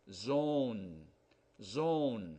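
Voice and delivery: "zone" with a long O like the letter name. Shown as a demonstration. Teacher reading the word with the E added. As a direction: round and hold the O in "zone". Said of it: "long sound".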